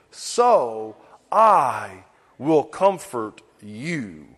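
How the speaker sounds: put-on slow, low and gentle, like soothing a child; tender promise. Preacher reading God's words to a weeping child, tender and slow.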